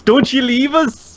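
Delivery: high, slow, wailing plea; drawn-out pleading wail; dramatic plea. Retold or imitated. Friend's mock plea quoted.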